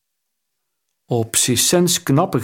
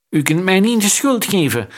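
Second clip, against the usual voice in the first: shouting; 7 semitones higher than usual. A narrator's reading. Bejewelled accomplice shouting at the queen, desperate. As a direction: yell it out to dodge the blame. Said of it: "schreeuwde zijn met sieraden behangen medeplichtige".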